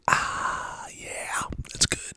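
hushed, breathed-out relish after a sip; soft whispery "ah" of satisfaction; satisfied sigh. Real, not acted. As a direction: savour the coffee under your breath. Savours a sip of coffee.

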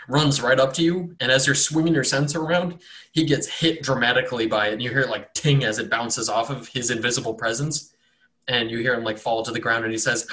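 ordinary delivery

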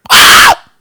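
sudden loud, high shriek; mock a terrified girly scream; imitated girlish scream. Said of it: "Like a girl".

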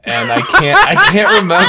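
cracking up mid-sentence; high giggling words; laughing. Real, not acted.

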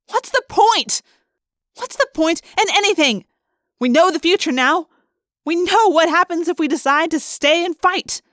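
high, loud, fed-up protest; exasperated outburst. A narrator's reading. Rachel despairing to her friends about the future, exasperated. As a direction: rail at the pointlessness.

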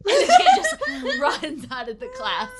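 loud, high, laughing narration; helpless laughter breaking up the line; cracking up. Real, not acted. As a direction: laugh hard through the words. Game master cracks up at own joke.

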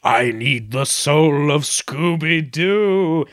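monster Scrappy-Doo. Retold or imitated. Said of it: "the giant monster Scrappy-Doo says".

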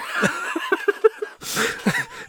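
breathless wheezing laugh; unvoiced high giggle. Real, not acted. Podcaster wheezing with laughter after the cop bit.

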